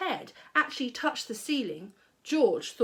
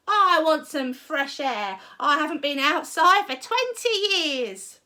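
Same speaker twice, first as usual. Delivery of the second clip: put-on old grandma shouting; 5 semitones higher, 6 dB louder than usual. A narrator's reading. Grandma shouting down to George. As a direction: holler it defiantly.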